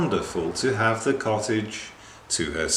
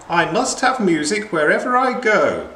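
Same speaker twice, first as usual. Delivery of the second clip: high, loud, lofty grande dame; haughty; 10 semitones higher, 6 dB louder than usual. Acted. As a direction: look down on everyone as you demand it. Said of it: "said the fine lady haughtily".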